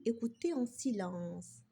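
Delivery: ordinary delivery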